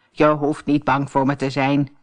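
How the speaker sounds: put-on calm the frightened girl; old woman reassuring.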